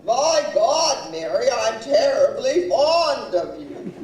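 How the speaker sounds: loud, emphatic declaration in mimicry; imitated exasperated husband; acted husband protesting. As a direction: protest devotion loudly as the husband.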